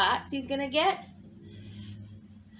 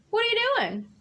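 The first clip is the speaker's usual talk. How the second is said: chirpy raccoon; 7 semitones higher, much faster than usual. Acted. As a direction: hail Bird cheerfully as Raccoon. Raccoon asks Bird.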